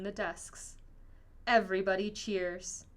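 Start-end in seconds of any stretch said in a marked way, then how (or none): none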